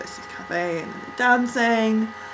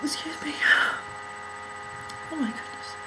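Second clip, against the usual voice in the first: whispered excuse; 3 semitones higher, 8 dB quieter, faster than usual. Real, not acted. Quiet aside after coughing.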